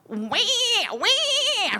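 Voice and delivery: imitating a newborn's cry, rising high on the second wah; a quick mock baby wah-wah; baby's wail. Nurse mimics the newborn's first cry for the audience, a high mock wail.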